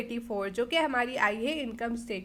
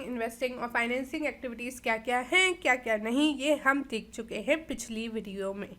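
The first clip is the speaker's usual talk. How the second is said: normal talking voice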